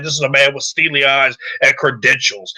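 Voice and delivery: ordinary delivery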